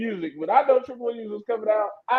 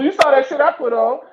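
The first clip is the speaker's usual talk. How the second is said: normal talking voice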